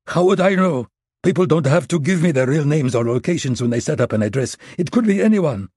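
high, loud, rattled protest; a frightened man lying to his captor; panicked denial. Acted. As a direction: bluff your way out in a panic. Maxim lies under threat.

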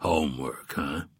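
low, doubting echo of homework; skeptical. A narrator's reading. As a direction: question it with low suspicion. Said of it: "he said in a skeptical voice".

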